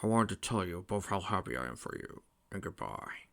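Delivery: hushed fatherly voice, fading at the end; subdued farewell, trailing to a murmur; gentle old king. A narrator's reading. King Acorn says goodbye to the brides, gentle.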